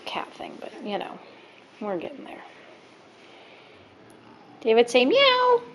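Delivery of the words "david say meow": very high, slow coaxing voice; sing-song prompt. Real, not acted. Asking toddler to meow.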